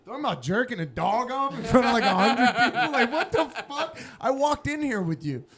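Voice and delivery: high, loud, appalled disbelief through laughter; hammed-up incredulous outburst; retold outraged girlfriend. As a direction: imitate an embarrassed date's rising indignation. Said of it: "Like, she was like".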